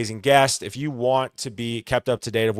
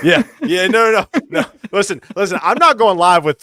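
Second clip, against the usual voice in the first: loud, laughing no no no; laughing protest; 8 semitones higher, 8 dB louder than usual. Real, not acted. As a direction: laugh it off loudly. Laughing denial.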